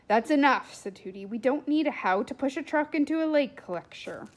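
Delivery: loud, sharp, no-nonsense interruption; stern cut-off. Acted. Tooty stops William's truck story, loud and stern. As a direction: shut him down firmly and loudly.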